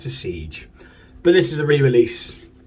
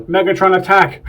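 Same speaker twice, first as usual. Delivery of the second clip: loud, higher-pitched talking-toy battle cry; Megatron toy; 6 semitones higher, 6 dB louder than usual. Retold or imitated. Collector imitates his brother's talking figure, loud toy voice. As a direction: imitate a toy's shouted catchphrase. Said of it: "hit the table and he'd go".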